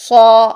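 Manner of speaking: سو as thick saw, held long; full-mouthed aw on سو; heavy aw syllable. Shown as a demonstration. Teacher contrasting saad with seen, سو with a heavy aw. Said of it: "اس کے لیے او".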